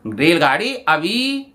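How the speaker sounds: normal talking voice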